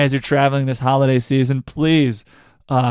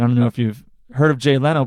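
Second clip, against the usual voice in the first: ordinary delivery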